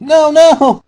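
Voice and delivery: high, loud desperate scream. Acted. Dash screams at Pinkie as the hammer comes down. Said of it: "Dash screamed".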